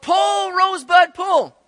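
high, loud yell to the horse; a farmer's shout across the field. Retold or imitated. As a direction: call the horse hard. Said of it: "the farmer yelled out".

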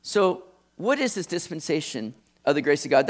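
usual speaking voice

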